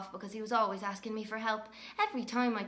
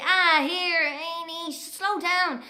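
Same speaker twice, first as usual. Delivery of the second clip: higher, louder protest; higher brotherly complaint; imitating Fionn; 8 semitones higher, 5 dB louder, much slower than usual. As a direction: mimic the flustered twin brother. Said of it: "And he'd say".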